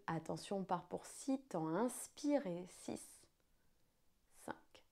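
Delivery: slow, hushed guided breathing count. Acted.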